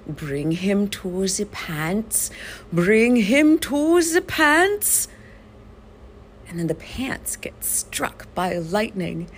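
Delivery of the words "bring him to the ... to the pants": put-on accented villain voice, building high and loud; slow mad-scientist command rising to a cry. Mad scientist in a cartoon retold, hammed-up and building. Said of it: "you see this mad scientist sitting off to the side saying".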